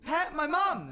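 high, loud, eager shout; thrilled yell in a child's role; excited child's cry. Acted. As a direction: call out high while running off. Child runs off into camp.

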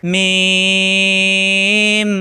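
মিম with a very long level vowel; drawn-out letter name. Shown as a demonstration. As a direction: hold the middle of মিম on one note. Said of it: "এখানে কিন্তু টান দিয়ে পড়া যাবে".

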